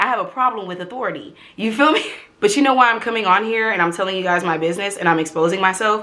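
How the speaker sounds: normal talking voice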